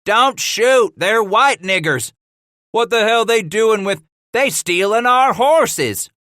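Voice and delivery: high, loud furious shouting; angry yelling. Acted. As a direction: yell in outrage, slowing at the end. Angry thief yelling at the wall.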